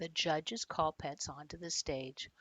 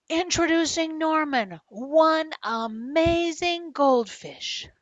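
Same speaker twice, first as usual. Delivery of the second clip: big, slow, loud showman introduction; announcer voice; 11 semitones higher, 12 dB louder, slower than usual. A narrator's reading. Boy announcing his fish to the crowd, loud and slow. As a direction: present the goldfish like a ringmaster.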